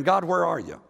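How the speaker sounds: usual speaking voice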